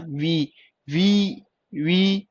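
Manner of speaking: वी with ई held long; slow, separate, stretched वी; long vowel. Shown as a demonstration. Teacher showing ई inside the word, long vowel. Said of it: "ई की sound आ रही है".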